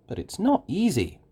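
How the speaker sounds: ordinary delivery, nothing marked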